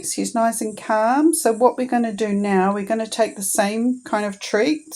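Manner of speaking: ordinary talk, nothing marked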